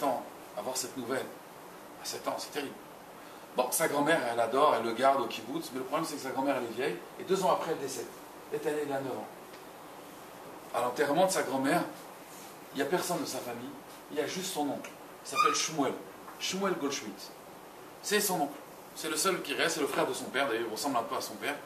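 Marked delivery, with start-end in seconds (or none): none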